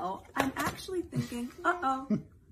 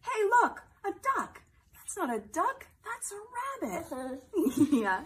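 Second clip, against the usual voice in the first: bright, playful read-aloud voice; reading to a toddler; 4 semitones higher, 4 dB louder than usual. Acted. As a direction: read it like a bedtime picture book for little ones. Celebrity reads picture book to kids.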